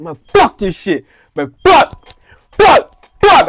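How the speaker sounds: high, loud swearing, climbing to a yelp; retold yelling. Thirteen-year-old pissed at his teacher, re-enacted yelling. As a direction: escalate the curse into a high-pitched yell. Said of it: "I was just yelling".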